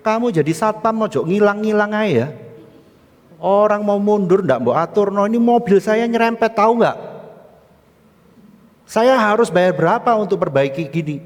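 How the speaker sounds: high, loud acted tirade; angry scolding. Woman scolding the guard over her scraped car, angry scolding. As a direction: berate the guard as an irate driver.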